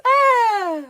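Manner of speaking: sudden high yelp; mock yelp. Streamer yelps at his own foreskin pun. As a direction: squeal at your own joke. Joke punchline on four skins.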